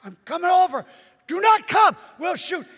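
high, loud shouting across the water; yelling. Retold or imitated. Soldiers and preacher yelling across the river. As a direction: shout back and forth over the river. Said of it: "they're yelling".

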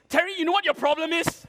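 high, loud confrontation; frustrated captain. Retold or imitated. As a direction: imitate an exasperated team captain telling someone off. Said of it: "he's obviously frustrated".

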